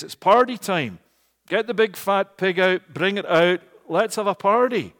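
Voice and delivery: loud, high party call; excited father. Acted. Father calling for a feast, loud and jubilant. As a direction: throw open the celebration, loudly.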